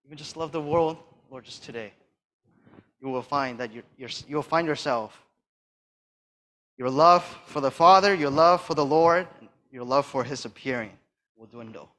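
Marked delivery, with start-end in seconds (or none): none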